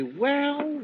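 a high, drawn-out sneer; mocking. Finch to the gentleman prisoner, sneering mockery. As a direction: savour the catch with scorn.

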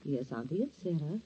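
low, soft, slow sweetness; tender reassurance. Acted. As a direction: coax the dying aunt. Niece comforting dying aunt.

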